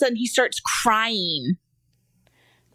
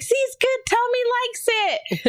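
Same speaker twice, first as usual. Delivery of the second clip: put-on very high, sing-out kid voice; imitated little girl. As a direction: mimic a child proudly proving her point. Imitates daughter boasting about taste test.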